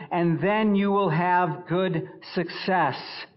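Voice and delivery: loud, high, driving pulpit voice; forceful preaching. Acted. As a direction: thunder the verse at the congregation. Preaches scripture at full force.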